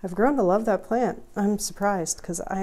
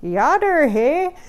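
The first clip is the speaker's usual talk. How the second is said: higher, louder Wisconsin greeting; Wisconsin accent; 6 semitones higher, 8 dB louder, slower than usual. Pretend. Street neighbours greeting the lumberjack in a Wisconsin accent. Voicing the people she meets.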